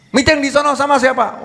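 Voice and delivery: high-pitched interrogating question; acted jealous questioning; suspicious wife.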